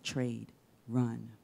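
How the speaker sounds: ordinary talk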